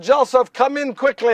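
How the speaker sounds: acted wife calling out; urgent call. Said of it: "I heard Mary call to me".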